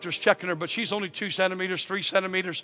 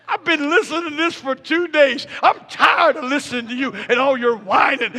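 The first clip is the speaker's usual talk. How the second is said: raised-voice mock complaint; acted annoyance; 6 semitones higher, 6 dB louder than usual. Husband goading laboring wife, acted irritation. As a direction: grumble loudly to provoke her. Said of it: "Make her mad".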